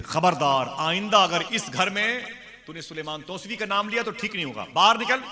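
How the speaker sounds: high, loud threatening tirade; acted angry shouting at a husband; furious scolding wife. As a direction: warn him, then shout him out of the house. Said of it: "बीवी ने बहुत डांटा है".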